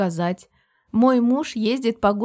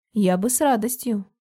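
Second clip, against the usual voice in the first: ordinary delivery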